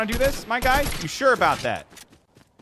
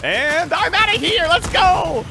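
much higher, louder, whooping getaway; excited shout; 12 semitones higher, 6 dB louder, slower than usual. Real, not acted. Gamer bolting from the fight, excited shout. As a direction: yell it as you bolt. Fleeing an enemy, yelling.

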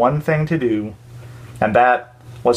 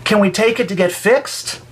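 put-on higher, pleading little girl; hopeful child; 8 semitones higher than usual. A narrator's reading. Emily asks her moms about the broken ball, hopeful child. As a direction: ask as a hopeful kid. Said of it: "said Emily".

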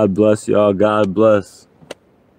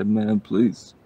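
ordinary talk

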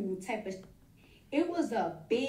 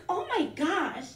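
put-on loud Staten Island girl; 8 semitones higher than usual. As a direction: squeal it like the doctor from Staten Island.

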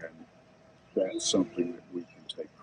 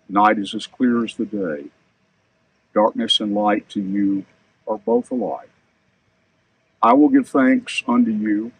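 measured psalm reading, raised voice; scripture reading; 2 semitones higher, 4 dB louder than usual. Acted. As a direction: read it like scripture at a service. Reads the psalm aloud.

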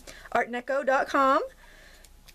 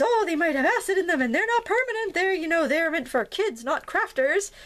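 lightly put-on prim voice, higher; a hint of a prissy spokesperson; mock company voice; 8 semitones higher than usual. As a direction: mimic the company's excuse with a sniff. Quoting a company's dismissive claim.

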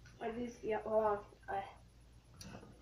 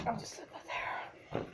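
whispering; 14 dB quieter, much slower than usual. Real, not acted. Aside while setting something down.

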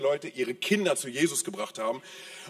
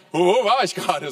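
caught off guard; 4 semitones higher, 7 dB louder than usual. Retold or imitated. Husband caught not listening.

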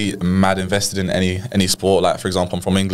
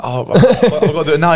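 whiny protest; 8 semitones higher, 5 dB louder than usual. Retold or imitated. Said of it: "I'm like".

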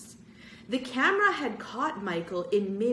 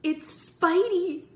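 excited cry; 12 semitones higher, 8 dB louder, much slower than usual. Acted. Kids spotting a stranger.

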